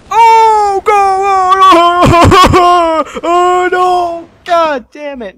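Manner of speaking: very high, loud wail broken by laughter; panicked yelling. Real, not acted. Player screaming at a disaster in game, panicked laughing yell. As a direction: scream in helpless dismay.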